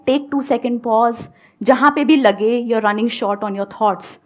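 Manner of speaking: usual speaking voice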